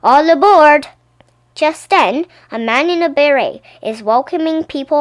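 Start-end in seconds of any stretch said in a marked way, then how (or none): 0.0-0.9 loud all aboard call. Acted. Boatman calling people aboard, loud. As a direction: call passengers onto the boat.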